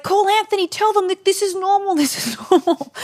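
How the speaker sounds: very high, hammed-up plea; mock pleading. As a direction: imitate a partner desperately insisting, laughing a little. Acts out partner's desperate plea.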